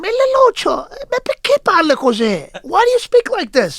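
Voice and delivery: high, loud exasperated aunt; aunt chiding him for his dialect, high and loud; scolding aunt. Retold or imitated. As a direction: squeal it like a scolding Italian aunt. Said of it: "my aunt tells me".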